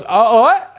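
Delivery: high, confused mock reply; imitated startled guy. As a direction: blurt it in mock bewilderment.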